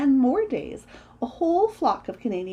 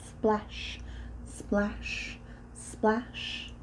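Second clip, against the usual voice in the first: soft, spaced-out splashes; unhurried, hushed oar strokes; slow splashes; 7 dB quieter, much slower than usual. A narrator's reading. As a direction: separate each splash with a lazy pause. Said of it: "and rowed slowly".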